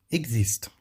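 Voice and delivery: "existe" as eg-zist, falling tune; French pronunciation demo. Shown as a demonstration. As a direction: model "existe" with X as GZ, silent final E. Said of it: "um G com S".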